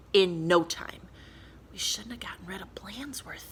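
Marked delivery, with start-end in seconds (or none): 1.7-3.5 soft unvoiced whisper. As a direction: whisper it so the teacher won't hear. Kids whispering in class.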